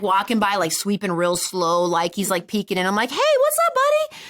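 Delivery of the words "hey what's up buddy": much higher, louder silly call; goofy greeting. Retold or imitated. Calling out to the janitor sweeping by, goofy and high. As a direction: sing out a high, dopey hello.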